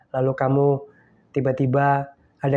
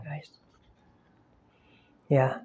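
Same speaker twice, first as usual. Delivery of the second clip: ordinary talk, nothing marked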